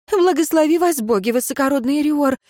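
high, twittering thanks; high-pitched grateful peasant woman; chirping. A narrator's reading. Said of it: "щебетала женщина".

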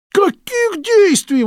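shrill high slow cry; disbelieving outburst; exclaimed. A narrator's reading. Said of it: "воскликнул Безил".

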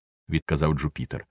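usual speaking voice

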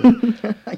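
a burst of high, loud laughter. Acted. Hans laughs at his friend's gloomy self-portrait, a loud high laugh.

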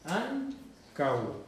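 Ancau split slowly as an-cau; two syllables. Shown as a demonstration. Teacher repeats Ancau to prove two syllables, careful demonstration. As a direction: draw out AN, pause, then CAU as a single syllable. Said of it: "Tiene dos sílabas".

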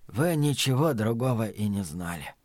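soft, low forgiving murmur; whispering. A narrator's reading. Dying man excusing his killer's ignorance, whispering. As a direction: murmur it with little strength left. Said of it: "прошептал он".

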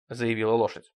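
ordinary delivery

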